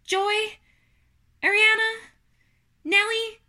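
high, loud formal address; proud character voice, pausing after each name; Wilbur making a speech. A narrator's reading. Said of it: "he should make a short speech".

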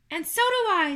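very high, loud little voice; high squeaky creature question; third little spider. Acted. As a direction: pipe up eagerly as a tiny spider.

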